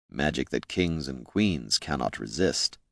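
low, slow, soft character voice; quiet, measured and low; Jin. A narrator's reading. Jin answers darkly and quietly.

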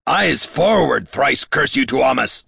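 exasperated commander's roar; roared command. A narrator's reading. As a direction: bellow the order, stretching the words. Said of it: "roared Damrod".

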